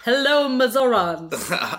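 slow alien greeting, Mazorans stretched; mock alien. Alien greets the Mazorans, slow and hammed-up. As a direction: greet earthlings as a silly alien. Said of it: "Take me to your leader".

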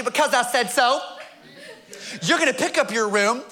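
put-on a touch of a Southern accent, mildly angry; angry Southern mother. Southern mother back from Arkansas scolding her son, mock-angry Southern accent. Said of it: "both angry and Southern".

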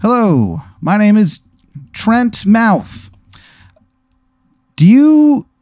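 high, bright-eyed TV pitchman; over-the-top infomercial voice; cheesy ad guy voice. Acted. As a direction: sell it like a late-night ad host. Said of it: "This guy's like".

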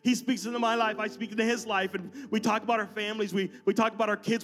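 earnest pastor in full flow; impassioned preaching. Real, not acted. Preaches to congregation at raised pitch.

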